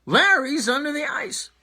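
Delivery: high panicked scream; frantic yell for help across the ice. Acted.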